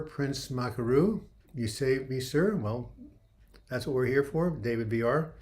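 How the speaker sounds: normal talking voice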